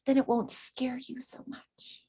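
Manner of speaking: soft reassuring whisper. Acted. Medium reassuring students about spirit voices, whispered. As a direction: whisper it gently to calm them.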